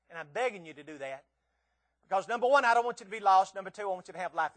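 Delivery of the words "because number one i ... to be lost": loud plea. Acted.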